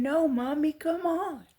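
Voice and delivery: put-on high, loud, playful child voice; imitating toddler. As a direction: mimic a little girl running off laughing. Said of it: "And she's saying".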